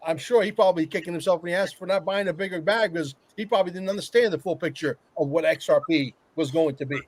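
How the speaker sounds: ordinary delivery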